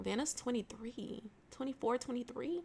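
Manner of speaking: unvoiced whisper to herself; murmured self-correction whisper; whispered aside. Real, not acted. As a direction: whisper it under your breath. Muttering to self.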